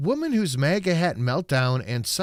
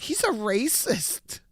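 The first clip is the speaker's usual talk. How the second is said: higher, slow mocking lady voice; mock shrill accusation; 11 semitones higher, slower than usual. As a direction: parrot her accusation, drawn out. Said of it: "and some lady's like".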